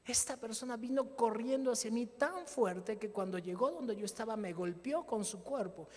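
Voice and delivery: ordinary delivery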